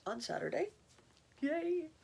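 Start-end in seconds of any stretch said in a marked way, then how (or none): 1.4-1.9 high, bright little yay of celebration; a quick happy whoop about graduating; excited cheer. Real, not acted. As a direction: cheer it like good news. Cheering her own graduation.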